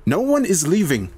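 high-pitched, firm command; ordering. A narrator's reading. Heather holding a gun stops them, commanding. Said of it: "she orders".

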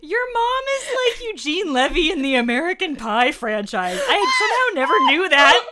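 high, loud laughing exclaim; laughing loudly. Real, not acted. Co-host reacting to the story, loud laughing. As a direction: howl it through laughter.

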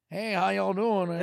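mocking a Southern twang; Southern accent. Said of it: "it's like the South".

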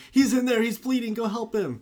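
high, loud mock panic, imitating a damsel screaming for help; panicked damsel in distress. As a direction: play up a panicked damsel act. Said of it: "starts screaming like she's just this panicked, like, damsel in distress".

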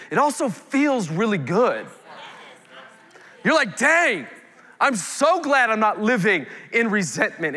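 high, loud, fervent pulpit preaching; preaching at full force. Acted. Pastor rousing the congregation about the Holy Spirit, full-force preaching.

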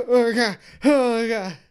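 high, loud, horrified exclamations; frantic high-pitched alarm; panicked cries. Acted. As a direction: wail in acted terror. Captive panicking over the bombs.

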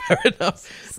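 high laughing reply. Real, not acted.

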